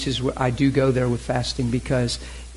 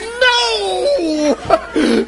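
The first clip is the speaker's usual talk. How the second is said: high, loud, laughing mock cry of no; squealed no through laughter; imitating a shocked no; 6 dB louder than usual. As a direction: play her startled protest for laughs. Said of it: "and she goes".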